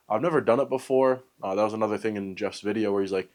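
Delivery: ordinary talk, nothing marked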